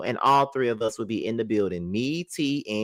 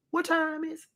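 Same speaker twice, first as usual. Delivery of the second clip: much higher put-on voice of a dazed woman; mock Letitia; 4 dB quieter than usual. Imitating Letitia dodging the question, high mock voice. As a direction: play her as clueless, pitched way up.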